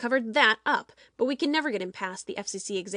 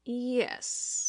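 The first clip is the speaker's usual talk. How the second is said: solemnly; 3 dB quieter than usual. A narrator's reading. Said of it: "he said solemnly".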